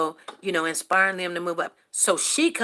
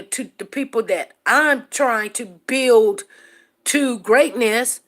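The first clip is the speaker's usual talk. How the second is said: slow, louder, each word leaned on; emphatic; 3 semitones higher, 4 dB louder, slower than usual. Real, not acted. To her viewers, slow and emphatic. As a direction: drag it out for weight.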